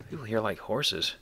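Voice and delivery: ordinary talk, nothing marked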